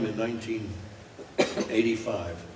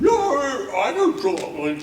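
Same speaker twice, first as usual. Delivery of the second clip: dog voice; 10 dB louder than usual. Retold or imitated. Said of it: "I said".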